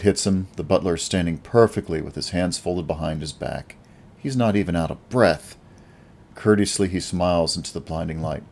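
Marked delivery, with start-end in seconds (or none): none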